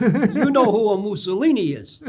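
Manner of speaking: put-on thick Italian accent, laughing through it; old Italian man's accent with a laugh; heavy Italian accent. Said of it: "in a very heavy Italian accent says to me".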